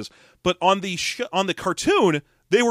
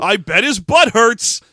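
slow, louder goofy quip; mock Alf joke; 5 semitones higher, 8 dB louder, slower than usual. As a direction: drag out the dumb line. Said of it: "continuing the mock joke".

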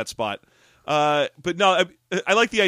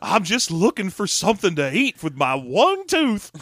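put-on higher slow toothless old-timer; old prospector; 4 semitones higher, slower than usual. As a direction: gum the words like an old coot. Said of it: "old prospector".

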